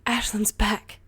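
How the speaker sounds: loud, high excited announcement; excited shout. Acted. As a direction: burst out with the news. Ray announces news breathlessly.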